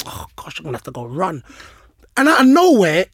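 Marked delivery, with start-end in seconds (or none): 0.1-1.4 low, very soft grumble under the breath; mumbling to himself. Retold or imitated. Player dreading the post-match run, mumbling to himself. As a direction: mumble it privately, barely voiced. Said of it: "So I'm mumbling to myself".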